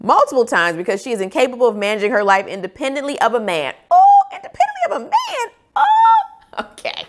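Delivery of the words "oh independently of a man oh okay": much higher, laughing mock outrage; sarcastic disbelief. Real, not acted. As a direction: scoff at the review with a laugh. Mocking a one-star review line.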